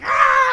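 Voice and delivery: high, loud wild shriek; rabid scream. Retold or imitated. Homeless woman charging after her, screaming. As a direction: screech like a wild animal giving chase. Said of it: "She's like".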